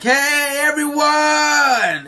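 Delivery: high, loud, stretched-out shout; joyful hail. Acted. Luffy calls to the crew from a giant bird, shouting. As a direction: holler to everyone from a distance.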